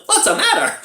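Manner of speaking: high-pitched caricature of a husband; mock concerned husband. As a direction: squeak the question out, half laughing. Imitating husband as wife pukes.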